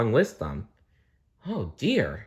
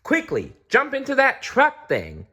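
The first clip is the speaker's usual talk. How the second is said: Blinky's voice. A narrator's reading. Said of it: "said Blinky".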